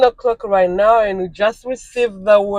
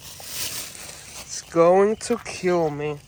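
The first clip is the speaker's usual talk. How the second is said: dramatic dread; 3 semitones lower, 6 dB quieter, much slower than usual. Real, not acted. Dread at heavy items.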